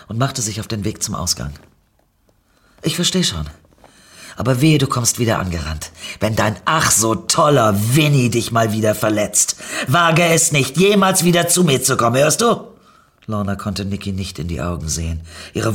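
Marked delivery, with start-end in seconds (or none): 2.8-12.7 hurt, louder threat; bitter parting threat at the door; bitter warning. Acted. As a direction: warn her off, stung and furious.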